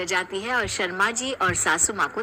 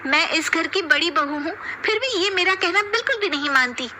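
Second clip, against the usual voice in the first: high-pitched whiny daughter-in-law; complaining elder bahu; 8 semitones higher than usual. Acted. Eldest daughter-in-law complaining to mother-in-law, high and whiny. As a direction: protest to mother-in-law, aggrieved.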